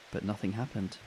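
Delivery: usual speaking voice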